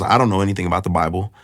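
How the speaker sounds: rattled off fast, a light imitation of the guide; quick dismissive archaeologist. Tour guide brushing off the Bible, fast and offhand. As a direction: toss it off quickly like it doesn't matter.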